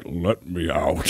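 low, slow, quiet creature plea; hushed, deep mock growl of words; stomach voice. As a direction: voice a pleading gut, deep and drawn out. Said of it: "my stomach was like".

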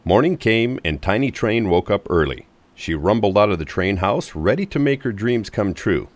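ordinary delivery, nothing marked

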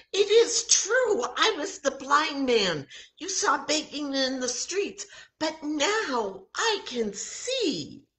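high, loud and overjoyed; healed man telling the crowd, overjoyed; joyful proclamation. Acted. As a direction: burst out with amazed joy.

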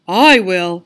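smiling cat. Acted. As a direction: say it with a smile. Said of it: "smiled the cat".

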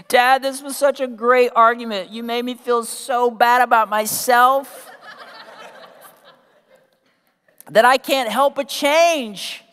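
high-pitched mock child voice; hammed-up, over-earnest kid praising Dad; gushing child. Child telling Dad his argument worked, mock gushing.

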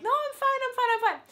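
high-pitched fake-casual "I'm fine"; squeaky insisting. To her worried friend, brushing it off in a high squeaky voice. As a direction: chirp it way up high, pretending all is well.

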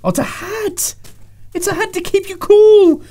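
very high-pitched, delighted squeal; excited discovery. Real, not acted. Discovers the gadget is a hat.

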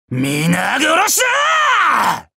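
slow, swelling war shout; drawn-out battle cry. Acted. Warrior ordering everyone slaughtered, long savage battle cry. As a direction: stretch the war cry out, rising then falling.

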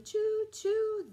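high, loud sung choo choo; a playful whistle for the kids; train whistle choo. Acted.

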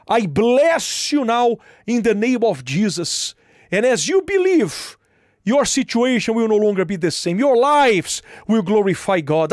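earnest pronounced blessing; preaching blessing. Real, not acted. Blessing listeners.

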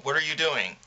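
ordinary delivery

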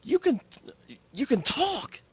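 a coworker amazed to hear a normal voice; surprised cashier. Retold or imitated. Cashier amazed her coworker can talk, high surprised squeal. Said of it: "and she was like".

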